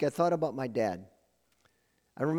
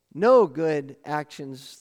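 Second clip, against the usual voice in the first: NO good actions, slow and loud; slow emphasis; 6 dB louder, much slower than usual. Real, not acted. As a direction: lean hard on each word. Preacher stresses no good works.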